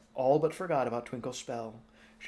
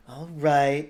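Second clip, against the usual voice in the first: put-on louder piping little-boy voice; piped; 2 semitones higher, 9 dB louder than usual. Twinkle the child answering Sophie, louder piping voice. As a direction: chirp it out like a small boy. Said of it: "Twinkle piped".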